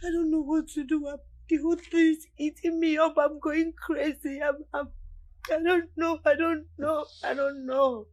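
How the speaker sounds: loud, desperate repeated cries; anguished outburst. Acted. Overwhelmed wife cries out to her husband, loud and desperate. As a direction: cry it out in despair.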